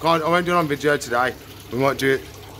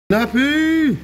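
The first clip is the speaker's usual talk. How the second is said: excited call; 11 semitones higher, 4 dB louder than usual. Real, not acted. Calling pet by name.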